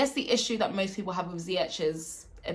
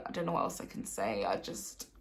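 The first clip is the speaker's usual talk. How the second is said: much lower and much softer, trailing to a breath; defeated mumble; 10 semitones lower, 9 dB quieter than usual. Real, not acted. Fan giving up on his team, dropping to a near whisper. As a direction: let it sink away, beaten.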